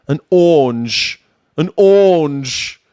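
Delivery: drawn-out English "ORANGE" with the r dropped, like "awnge"; English accent. Shown as a demonstration. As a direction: stretch "orange" slowly into a British "awwnge". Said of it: "I think they would say an orange".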